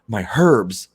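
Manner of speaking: loud worried cry; wife fretting over her plants in the storm, loud; mock alarm. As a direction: cry out for the herbs as if they're in danger. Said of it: "she always says".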